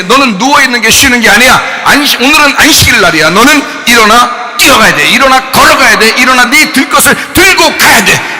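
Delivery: loud, high ringing command to rise; commanding shout. Acted. Jesus ordering the sick man to get up, a loud commanding shout. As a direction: call him up off the mat with full force.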